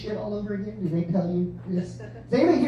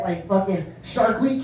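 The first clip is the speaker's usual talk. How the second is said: ordinary talk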